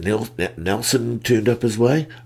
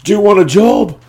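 calling down; 13 semitones higher, 10 dB louder than usual. Retold or imitated. Lance offers job.

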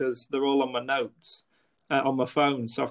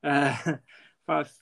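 ordinary delivery

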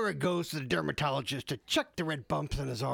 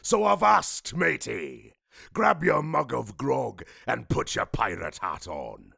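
growly pirate announcer; 6 semitones lower than usual. Acted. Podcast intro done pirate style.

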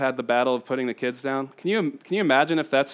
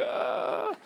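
put-on high breathy child's gasp, much softer; frightened gasp; 11 semitones higher, 9 dB quieter than usual. Father imitating his scared older daughter. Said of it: "the older girl's like".

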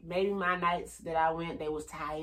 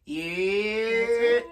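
interview dude voice; 5 semitones higher, 6 dB louder than usual. Shown as a demonstration. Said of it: "You know how they do in the interviews".

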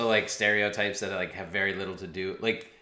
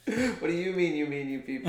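laughing quote; 7 semitones higher than usual. Retold or imitated. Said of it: "Tropic Thunder".

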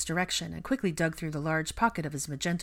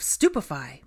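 forceful, raised-volume cry; loud spell call; 6 dB louder, slower than usual. A narrator's reading. George calls a stunning spell at his twin, loudly. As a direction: cast it out loud, short of screaming. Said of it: "echoed loudly in her ears".

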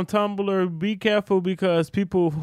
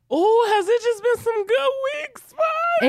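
high teasing voice. Real, not acted. Calling friend teasingly.

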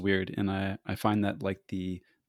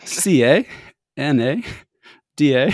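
loud, higher letters with Canadian "eh"; acted spelling joke; 6 semitones higher, 10 dB louder than usual. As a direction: call each letter out as a punchline. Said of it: "and they said".

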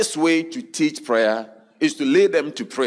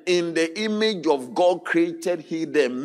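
loud, raised preacher's voice; preaching at full force; 7 semitones higher, 4 dB louder than usual. Acted. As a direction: drive the verse home to the crowd. Preaching the verse again.

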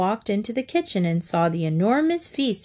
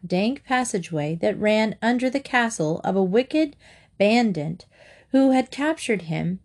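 usual speaking voice

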